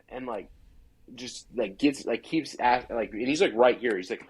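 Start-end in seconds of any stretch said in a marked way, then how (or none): none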